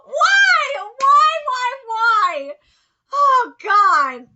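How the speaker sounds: high, loud wail of why; mock despair, crying out to the dub; anguished cry. Real, not acted. Viewer crying out at the dub in loud mock anguish.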